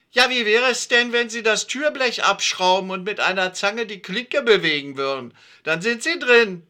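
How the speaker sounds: high, loud, sarcastic woman; mocking neighbour. A narrator's reading. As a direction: talk down to them sweetly. Frau Dörge voiced, mocking.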